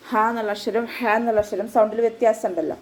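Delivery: ordinary delivery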